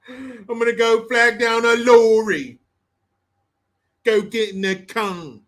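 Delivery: loud, slow, hammed-up British accent; mock British accent. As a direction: parody a Brit, stretching "lorry". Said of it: "Sorry, English people. I'm just, I'm just on you today".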